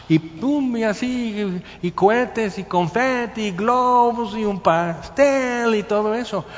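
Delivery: high, loud festive rush; excited party. Pretend. Preacher pictures the father's homecoming celebration, high and excited. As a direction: pile up the party things with growing joy.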